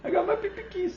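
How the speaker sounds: pee-pee keys in a high mocking squeal; squeaky silly voice. Real, not acted. Repeating the silly name, high squeaky voice.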